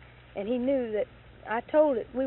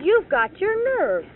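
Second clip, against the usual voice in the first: loud, higher, slow outrage; indignant; 9 semitones higher, 12 dB louder, slower than usual. Retold or imitated. Speaker telling off her boss, slow and indignant. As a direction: spit out the words in disbelief. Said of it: "I said".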